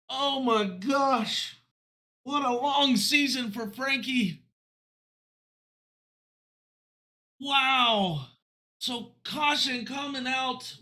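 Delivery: high, amazed race call; live play-by-play. Real, not acted. Announcer recapping Frankie's long-awaited win, amazed play-by-play. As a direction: marvel aloud as you recap the finish.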